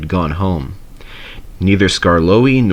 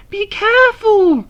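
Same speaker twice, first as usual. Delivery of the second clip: very high, loud panicky cry; a coach begging for caution; shrill high plea; frightened cry; 4 dB louder than usual. A narrator's reading. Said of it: "screamed the coach".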